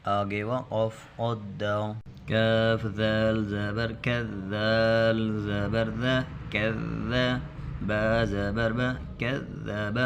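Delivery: slow drawn-out syllable recitation; demonstration reading. Shown as a demonstration. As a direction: model the reading for the pupils. Teacher recites the spelling drill. (ہجے پڑھ کر دکھانا)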